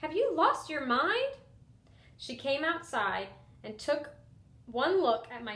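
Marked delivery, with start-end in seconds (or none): 0.0-1.4 high, loud scolding yell; an appalled mother's shout; mom yelling. Acted. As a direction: shout it in disbelief. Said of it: "she yelled".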